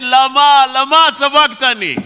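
high, loud lament to heaven; mock anguished cry. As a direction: cry out like a forsaken man, hammed-up. Mock cry over his unheard prayer.

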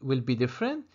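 ordinary talk, nothing marked

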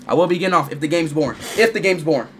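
usual speaking voice

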